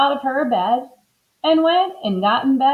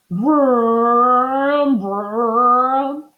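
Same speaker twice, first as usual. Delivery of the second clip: long, drawn-out engine vrooms; dreaming car noise; 3 semitones higher, 4 dB louder than usual. A narrator's reading. Mister Watson driving fast in his dream, revving vrooms. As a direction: rev the words like a car. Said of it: "Mister Watson said".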